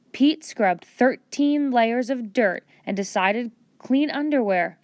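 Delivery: plain reading voice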